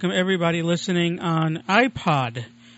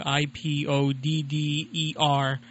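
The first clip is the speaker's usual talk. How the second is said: spelled out; much slower than usual. Shown as a demonstration. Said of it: "a site called I podder dot org".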